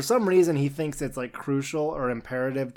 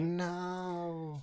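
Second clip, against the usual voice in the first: mock despair; 4 semitones higher, 9 dB quieter than usual. Caged character's retold protest.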